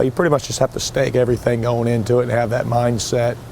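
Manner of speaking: usual speaking voice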